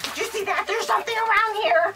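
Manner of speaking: high, loud, jittery pretend alarm; mock spooked. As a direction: act spooked, as if something flew by. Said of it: "And I'd be at home like".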